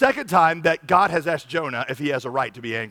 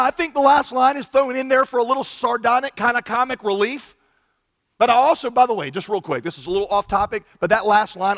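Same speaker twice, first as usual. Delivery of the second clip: ordinary talk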